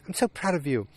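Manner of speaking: high put-on voice of an insistent wife; mock-wifely insistence in a high voice; wife's voice. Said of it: "And she's like".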